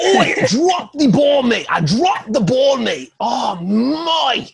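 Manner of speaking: put-on over-the-top Australian accent with a cry. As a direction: bellow it like a cartoon Aussie. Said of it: "your famed Australian accent".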